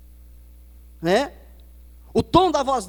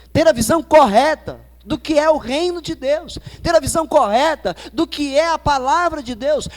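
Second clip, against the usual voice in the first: louder, rising preacher's cadence; fiery preaching; 5 semitones higher, 7 dB louder than usual. Acted. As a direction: drive each line home to the church. Preaching at full force.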